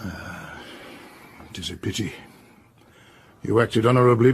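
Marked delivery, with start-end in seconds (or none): 0.0-2.1 much softer, lower sigh of regret. Acted. Detective regrets the maid burned the papers, soft sigh. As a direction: let the disappointment sink out.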